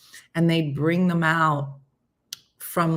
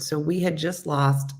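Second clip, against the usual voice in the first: normal talking voice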